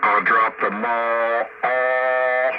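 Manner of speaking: ordinary delivery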